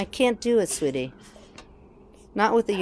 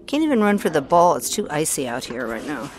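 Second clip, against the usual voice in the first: ordinary delivery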